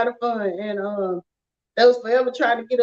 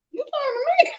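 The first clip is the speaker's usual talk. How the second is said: high, amazed "me?"; surprised disbelief; 12 semitones higher than usual. Retold or imitated. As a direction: act shocked he picked you. Retells disbelief at being chosen.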